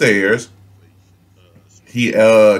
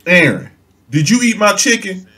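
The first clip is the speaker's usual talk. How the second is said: mom asking; 6 semitones higher than usual. Retold or imitated. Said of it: "So she asked him".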